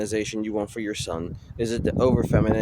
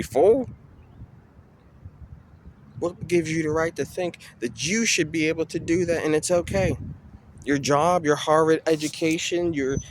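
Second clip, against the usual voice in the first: pitched up, indignant demands of an official; rising outrage; 5 semitones higher than usual. Real, not acted. Challenging the official who hurt him, pitched up and indignant. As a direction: demand answers, voice climbing.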